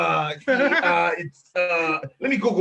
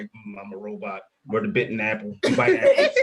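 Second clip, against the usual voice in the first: mock robot, low and flat then squeaking up on the last line; imitating a stiff robot, lightly; 8 semitones lower than usual. Guest imitating imagined stiff robot interviewers, lightly mock robot. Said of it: "these guys might be like".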